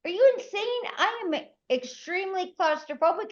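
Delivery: high, indignant disbelief. Retold or imitated. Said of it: "I'm like".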